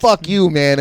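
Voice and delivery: put-on loud, high drunk insult; mock angry shout. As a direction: bark it at the kid. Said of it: "And he goes".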